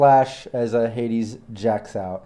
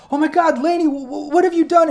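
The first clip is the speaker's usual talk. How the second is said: high, frantic cry of alarm; panicked gasp. Acted. Med tech panicking over her dead colleague. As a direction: shriek it out in horror. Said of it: "She gasps and she looks over and says".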